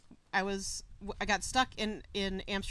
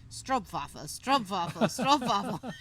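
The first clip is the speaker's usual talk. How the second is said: louder and louder; 5 dB louder than usual. Retold or imitated. Dutch woman behind, louder and louder over the American's chatter. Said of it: "and louder and louder".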